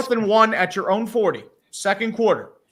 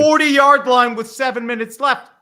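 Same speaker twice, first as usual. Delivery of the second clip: loud, slow and incredulous; exasperated outburst; 6 semitones higher, 8 dB louder, slower than usual. Real, not acted. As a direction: hammer the point in disbelief. Exasperated rant on punting.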